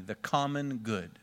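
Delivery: slow and deliberate, for the church to echo; slow call-and-repeat. Shown as a demonstration. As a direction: pastor modelling the phrase for the church to repeat back, slowly. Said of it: "Repeat after me".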